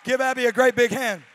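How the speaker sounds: calling out for applause. Real, not acted.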